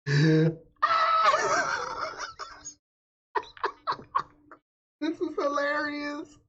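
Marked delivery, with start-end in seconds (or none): 0.1-0.5 loud, high, laughing look at her; squealing with laughter, pointing it out; cracking up at the stylist's face; laughing excitedly. Real, not acted. 0.8-2.7 loud squealing laugh; high shrieking laugh. Real, not acted. Cracking up at the video.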